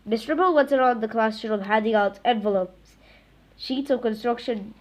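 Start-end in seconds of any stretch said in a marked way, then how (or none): none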